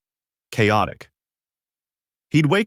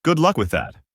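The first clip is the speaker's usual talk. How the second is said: smug, higher teasing voice; smirking; 5 semitones higher than usual. A narrator's reading. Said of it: "Ethan smirked".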